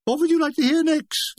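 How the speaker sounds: high, singsong question to the audience; mock-yodelled stage patter; yodelled call. A narrator's reading. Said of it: "he yodeled".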